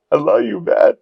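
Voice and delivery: high-pitched starstruck declaration; gushing fan. Retold or imitated. Starstruck fan answering the actor on stage, gushing. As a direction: blurt it out like an adoring fan.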